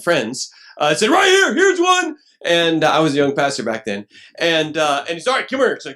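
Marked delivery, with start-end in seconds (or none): 1.1-2.2 much higher and louder, slow playful call. Retold or imitated. Friends calling out in crowd. 5.3-5.9 summoning call. Retold or imitated. Pastor summoning him on stage.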